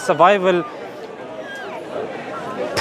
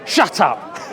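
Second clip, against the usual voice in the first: rude Londoner; 2 semitones lower than usual. Retold or imitated. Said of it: "Here in London".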